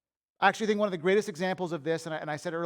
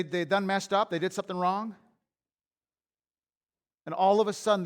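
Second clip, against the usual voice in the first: ordinary talk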